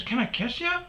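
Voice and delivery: very high put-on female voice; stripper impression.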